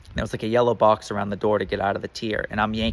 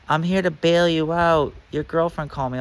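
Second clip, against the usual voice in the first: woman's voice, lightly put on; 7 semitones higher than usual. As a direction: explain it calmly in a higher voice. Bail woman explains.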